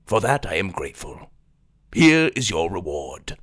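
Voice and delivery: low, sly courtly voice; a hint of a sly royal schemer; oily scheming vizier.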